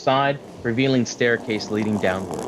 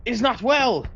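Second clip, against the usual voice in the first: much higher, urgent alarmed cry; panicked soldier; 9 semitones higher than usual. Acted. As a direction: sound the alarm over the waking advisor. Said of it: "He's like".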